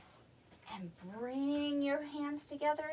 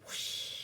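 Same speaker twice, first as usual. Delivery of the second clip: long airy whoosh; whoosh of spraying water; 3 dB quieter than usual. Shown as a demonstration. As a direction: imitate water shooting from a trunk. Imitates spraying trunk water.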